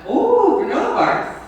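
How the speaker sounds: high, loud burst of joy on the oh; a kid thrilled to find snacks; delighted kid. Retold or imitated. Student spotting granola bars on the table, delighted yelp. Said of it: "And he was very happy".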